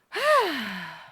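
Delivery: loud drawn-out sigh; heavy sigh. Acted. Streamer sighing before going live. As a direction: let out a big theatrical sigh. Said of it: "Heavy sigh".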